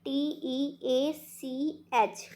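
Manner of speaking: slow, letter-by-letter spelling of टी ई ए सी एच; spelled out. Shown as a demonstration. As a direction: spell टी ई ए सी एच out one letter at a time, slowly. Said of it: "मैं समझा देती हूँ teacher को". (टी ई ए सी एच, एक-एक अक्षर धीरे-धीरे)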